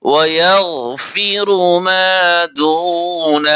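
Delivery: very slow tajweed chant; Quran recitation. Shown as a demonstration. Teacher recites the verse for students, slowly chanted. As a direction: stretch every vowel in a reverent chant.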